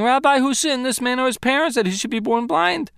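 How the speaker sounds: high, hurried disciple's question; questioning disciples. Acted. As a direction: rattle off the question pitched right up. Reads the disciples' question in their voice.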